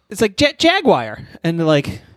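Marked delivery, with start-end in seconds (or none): 0.4-1.2 excited insistence. Acted. [name] repeats Jet Jaguar.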